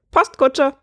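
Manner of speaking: high, quick squawk; bird's punchline. Acted. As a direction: squawk the answer out triumphantly. Bird gives his punchline.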